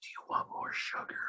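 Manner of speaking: very soft, hushed little-lady offer; a tiny lady quietly offering; little old lady. Retold or imitated. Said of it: "some little lady would be like".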